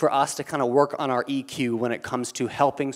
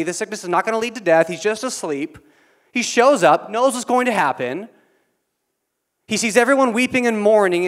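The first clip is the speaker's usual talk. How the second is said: higher, louder preacher's drive; fervent preaching; 8 semitones higher, 5 dB louder than usual. Acted. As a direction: preach it with rising fire. Preaching at full force.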